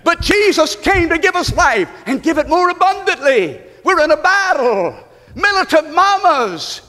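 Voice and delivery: high, driving pulpit exhortation; rally the congregation with punched phrases; full-force preaching. Real, not acted.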